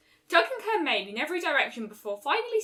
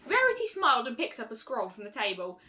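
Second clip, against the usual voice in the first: usual speaking voice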